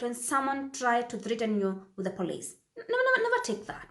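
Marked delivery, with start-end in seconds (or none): 2.8-3.9 high-pitched "never" repeated; emphatic insistence. Real, not acted. Vlogger urging viewers not to fear police threats, high and insistent. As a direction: push every "never" up high.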